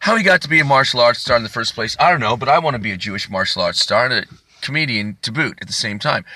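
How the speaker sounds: normal talking voice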